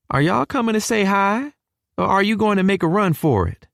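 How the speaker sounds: high-pitched Texan mother's call; southern mama calling out. A narrator's reading. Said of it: "Mama shouts from the kitchen".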